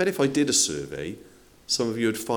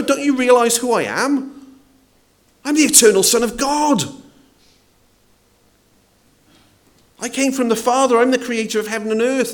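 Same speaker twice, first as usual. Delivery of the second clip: loud, much higher indignant scolding; berating rebuke; 12 semitones higher, 8 dB louder than usual. Pretend. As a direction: thunder it out as an offended lord demanding respect. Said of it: "he doesn't berate them and say".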